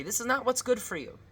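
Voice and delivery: high-pitched explaining parent; parent refusing. Acted. Parent telling a child no, lightly acted refusal. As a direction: lift the pitch, lightly voiced firm parent.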